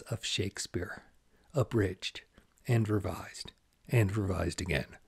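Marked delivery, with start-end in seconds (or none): none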